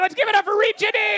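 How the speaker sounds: high, loud, fast call for applause; rally the crowd like an MC; soaring cheer of introduction; hype announcer shout. Acted. Host calls for applause.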